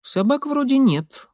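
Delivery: high-pitched Molly, thinking aloud; Molly reasoning. A narrator's reading. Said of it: "рассудила Молли".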